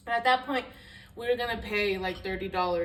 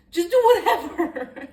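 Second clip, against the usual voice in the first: much higher and louder, slow, laughing mimicry of an irritated boyfriend; mock annoyed shout; 10 semitones higher, 14 dB louder than usual. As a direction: bark it like a fed-up partner.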